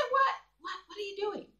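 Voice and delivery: high-pitched, incredulous comeback; mock indignation. Presenter voicing the quarrel on a silent video, mock indignation.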